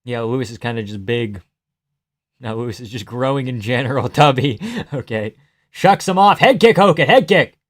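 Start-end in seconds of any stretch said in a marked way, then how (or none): 5.7-7.6 higher, louder excited urging; urgent calling out. Real, not acted. Viewer urging fighter to throw a head kick, excited calling.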